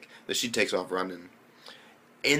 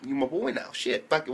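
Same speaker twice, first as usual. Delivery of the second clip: usual speaking voice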